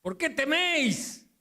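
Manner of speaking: high, irritated shout; mock angry yell. Jesus imagined yelling at the disciples who woke him. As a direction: snap back as if rudely woken. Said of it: "¿Habrá gritado Jesús en ese momento?".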